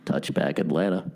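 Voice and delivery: deep deadpan PA call; announcer voice. Retold or imitated. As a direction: drop into a flat stadium announcer. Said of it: "the announcer just goes".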